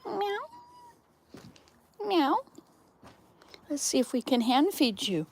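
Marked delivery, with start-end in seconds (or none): 0.0-2.4 high playful imitated meow; high mock meow aimed at a cat. Meowing at the cats.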